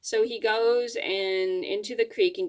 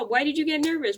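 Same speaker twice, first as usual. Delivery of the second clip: higher-pitched mock scolding; retold mad mom; 7 semitones higher than usual. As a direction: imitate mom pressing for an answer. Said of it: "mad at me because I didn't tell".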